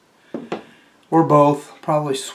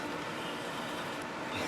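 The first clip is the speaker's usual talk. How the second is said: soft laugh; 14 dB quieter than usual. Real, not acted. Laughs after success.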